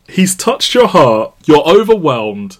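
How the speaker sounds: loud, slow mock-dramatic quote; hammed-up film line. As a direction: declaim it slowly and loudly, hamming it up.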